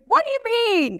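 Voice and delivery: high, baffled question; genuinely thrown by the compliment; puzzled disbelief. Retold or imitated. Told she's funny, she asks in high disbelief. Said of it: "And I was like".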